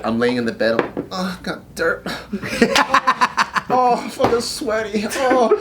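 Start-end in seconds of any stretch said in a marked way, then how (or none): 1.1-2.1 high, mock-fussy moaning; hammed-up squeamish whimper; whiny inner self. Recruit lying in bed disgusted by dirt, high mock whine. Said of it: "who I am on the inside". 3.7-5.5 high, mock-miserable moan; hammed-up squeamish groaning through laughter; whiny inner self. Recruit lying sweaty in bed, high mock whine. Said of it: "who I am on the inside".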